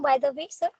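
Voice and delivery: usual speaking voice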